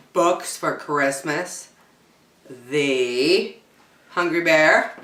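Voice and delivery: plain reading voice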